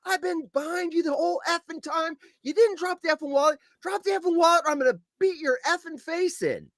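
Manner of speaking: high, heated confrontation with a thief; worked-up, threatening retelling; angry retold threat. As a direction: re-enact chewing him out, pitch well up.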